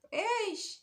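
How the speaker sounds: high, sharp Eish of disbelief; exclamation. Real, not acted. Telling her friends about her shock, a high Eish. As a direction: cry out in disbelief.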